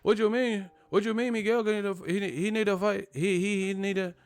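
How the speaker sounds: hammed-up Puerto Rican accent on an angry fan; mock Puerto Rican accent. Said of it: "Puerto Ricans was hot at me".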